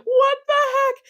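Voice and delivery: high pitched outraged squawk; shocked what the heck. Retold or imitated. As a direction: yelp it as the cramp hits. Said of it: "I was like".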